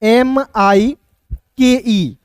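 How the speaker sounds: letters "एम आई के ई" drawn out very slowly; slow spelling. Shown as a demonstration. Teacher spelling mike letter by letter, very slow. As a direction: spell each letter with long gaps. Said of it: "इसे कैसे लिखते हैं".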